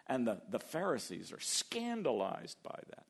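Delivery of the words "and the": ordinary talk, nothing marked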